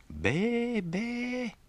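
high bleating be be; goat bleat. Acted. Goat bleats after answer.

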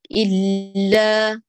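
إلا slow, the lam sent through the nose; nasalized lam. Shown as a demonstration. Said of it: "توجه الصوت إلى الخيشوم".

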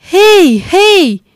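high, loud hail; calling out. Acted. Scrooge calling to Friday running to the stream, loud call. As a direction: shout after someone running off.

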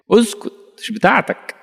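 high, sharp put-on voice silencing someone; an elder's mock hush, pitched high; mock scolding elder. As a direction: shut the kid up.

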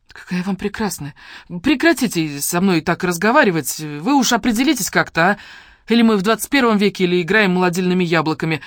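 loud, high-pitched protest; indignant. A narrator's reading. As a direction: object with rising outrage. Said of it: "возмутилась Василиса".

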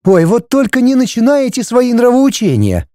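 high-pitched airy brush-off; flippant. A narrator's reading. Wife dismissing husband's moralizing, flippant. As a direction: wave away the lecture. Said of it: "легкомысленно махнула рукой Светлана".

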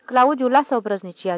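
stern mother putting her foot down; scolding. A narrator's reading. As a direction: tell off a cheeky child sharply. Mother scolds her cheeky son.

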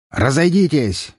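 slow, thundering order; booming command. A narrator's reading. Count orders police to step aside, booming. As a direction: make them scatter with one word. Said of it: "громыхнул голос за моей спиной".

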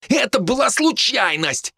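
high, drawn-out defensive protest; high-pitched defensive comeback; snappish retort. A narrator's reading. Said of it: "огрызнулся Хинниган".

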